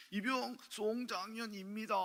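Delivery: slow, high recruit's report; awkward new private reporting slowly; korean military style. Retold or imitated. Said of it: "입니다 하지 마". (느리고 높은 신병 관등성명)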